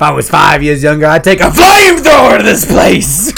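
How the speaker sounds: slow build to a high, loud roar; mock movie rant. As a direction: explode theatrically on the threat. Quotes Pacino film rant.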